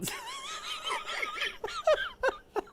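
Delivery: high, squealing laugh; long real laughing fit; helpless laughter. Real, not acted. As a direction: dissolve into high-pitched giggles. Cracks up at the reply.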